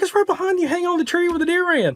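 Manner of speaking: high-pitched exasperated reply; confused. Retold or imitated. Hunter retelling his answer to his clueless buddy, confused. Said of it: "I was so confused".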